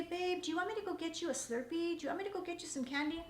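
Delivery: husband softly offering treats; tender offer. Retold or imitated. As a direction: coo the offer to a tired wife.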